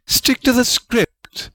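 a high, sharp snap; snapped. A narrator's reading. Rowena cutting off Salazar's excuse, sharp snap. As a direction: cut him off sharply. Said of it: "Rowena snapped".

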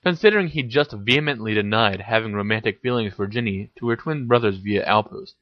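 usual speaking voice

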